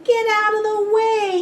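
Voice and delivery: high, loud and fast yell. A narrator's reading. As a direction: shout it out of the way, quickly. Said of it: "he yells with a beep".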